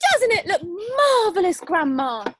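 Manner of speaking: high, loud, laughing call; boy calling up to grandma; delighted shout. Acted. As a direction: shout up in joy. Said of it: "George shouted".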